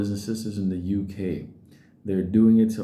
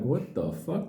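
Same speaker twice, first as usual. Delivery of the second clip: ordinary talk, nothing marked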